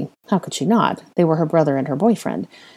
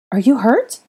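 higher, louder pressing question; urgent concern; 7 semitones higher, 5 dB louder than usual. Acted. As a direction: press with worry. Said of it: "Ginny pressed quickly".

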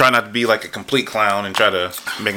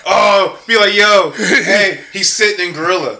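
laughing quote; 7 semitones higher than usual. Retold or imitated. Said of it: "He said, he said".

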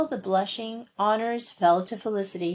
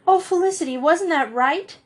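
dismayed plea; 8 semitones higher, 3 dB louder than usual. A narrator's reading.

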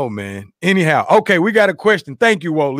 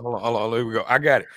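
ordinary talk, nothing marked

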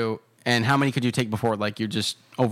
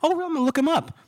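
much higher, curious father; high dad voice; faster than usual. Retold or imitated. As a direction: chirp it like a nosy dad. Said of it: "He's like".

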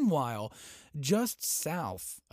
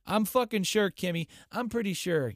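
loud, high mocking sarcasm; sarcastic; 7 semitones higher, 4 dB louder than usual. Real, not acted. Host mocking Kim's eternal president claim, loud sarcasm. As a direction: jeer at him, dripping scorn. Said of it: "Yeah, I'm sure".

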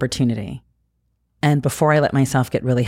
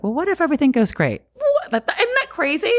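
bright, much higher, almost squealing; giddy high-pitched wonder; excited realization; 12 semitones higher than usual. Retold or imitated. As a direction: gasp at the wild idea. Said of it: "I was like".

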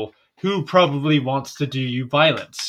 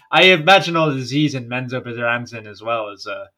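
usual speaking voice

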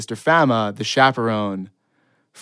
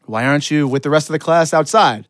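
raised, louder teacher's query; incredulous questioning; 4 semitones higher, 6 dB louder than usual. Acted. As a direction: press the pupil, baffled. Acts out chaperone questioning him.

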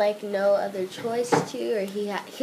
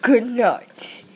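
sneering panto villain; 11 dB louder, slower than usual. Pretend. Child signs off the recording.

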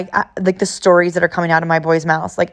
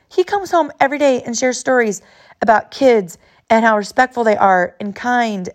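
ordinary delivery

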